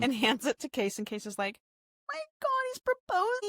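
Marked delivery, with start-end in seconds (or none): 2.1-3.5 squealed in a very high voice; a hammed-up shocked squeal; mock gasp of surprise. Case reacting to proposal.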